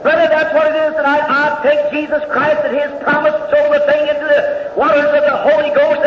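high, loud fervent preaching; preaching at full force. Acted. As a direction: shout the promises out like a revival preacher. Preaching climax, shouting and chanting.

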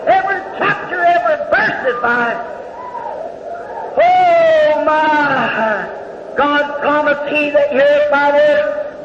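high, loud fervent preaching; preaching at full force. Acted. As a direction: shout the promises out like a revival preacher. Preaching climax, shouting and chanting.

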